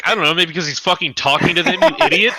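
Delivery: high, rapid scolding comeback; mock exasperated retort. Answering the jealous complainer, fast mock exasperation. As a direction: fire it back, rising on the insult. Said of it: "It's like".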